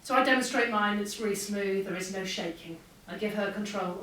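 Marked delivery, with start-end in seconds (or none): none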